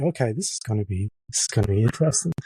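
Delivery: softly voiced retold misgiving; muted doubt. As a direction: say it low, as a doubtful note to yourself. Retold sceptical inner reaction.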